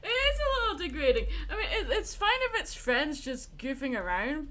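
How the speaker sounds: high, loud laughing speech. Real, not acted. Admitting it's degrading to viewers, laughing. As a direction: talk through a laugh.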